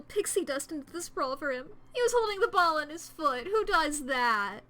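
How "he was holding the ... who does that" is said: loud, high, slowing on the question; indignant outburst. Acted. Confessing the strange smuggler, loud indignant outburst. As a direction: blurt it in disbelief.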